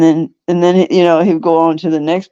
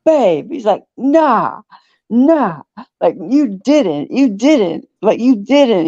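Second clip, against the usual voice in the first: higher-pitched, drawn-out disbelief; mock freaking out; 5 semitones higher, slower than usual. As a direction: imitate a boyfriend appalled at being sneezed on. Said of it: "you see him freaking out".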